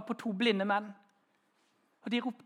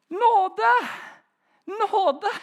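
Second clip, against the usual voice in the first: higher, louder desperate yelp; crying for mercy; 9 semitones higher, 7 dB louder than usual. Retold or imitated. Pinned boy after play-fighting, crying for mercy loudly. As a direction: beg for mercy at the top of your voice. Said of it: "så sa han alltid".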